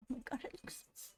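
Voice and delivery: unvoiced, very soft whisper; hushed disbelief. Real, not acted. Reacting under breath to the picture.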